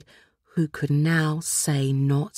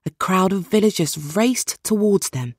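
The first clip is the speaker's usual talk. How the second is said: ordinary delivery, nothing marked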